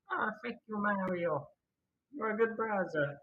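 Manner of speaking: high cartoony Mario voice; Mario impression. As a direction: play a chipper Mario. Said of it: "even I can do better Mario impressions".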